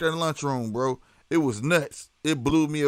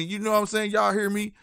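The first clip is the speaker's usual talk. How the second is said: usual speaking voice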